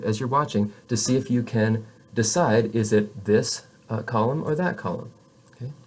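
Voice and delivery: ordinary talk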